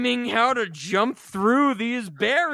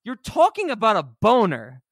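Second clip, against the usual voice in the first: louder and higher, slowing to make the point; incredulous rant at the screen; exasperated retort; 6 semitones higher, 4 dB louder than usual. Retold or imitated. Retelling his reaction to the movie, exasperated and loud. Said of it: "And I'm like".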